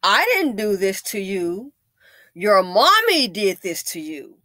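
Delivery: loud, slow, deliberate comeback; imitated owner's retort. Owner tells kid who is to blame, loud slow retort. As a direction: spell it out firmly to a child. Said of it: "You know what he told her?".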